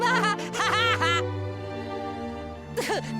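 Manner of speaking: gloating devilish laugh; villainous laugh. Acted. Devil pleased with his mischief, gloating laugh.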